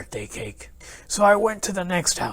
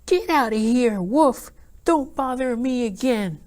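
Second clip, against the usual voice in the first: higher rude pig voice; rude pig answer; 7 semitones higher than usual. Acted. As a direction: snap at the wolf, high and piggy. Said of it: "that rude little porker answered".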